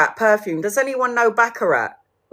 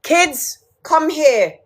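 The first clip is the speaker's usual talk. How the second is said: high-pitched mock summons; imitating father's drawn-up call; father calling children; 7 semitones higher, much slower than usual. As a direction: call the kids over like a dad. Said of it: "my father would be like".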